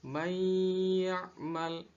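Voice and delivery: MAY YA'MAL chanted with a long humming y; merged idgham reading. Shown as a demonstration. Teacher reciting the third example, "may ya'mal" with held merge. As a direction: hold "may" nasally before "ya'mal". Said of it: "Pada contoh ketiga".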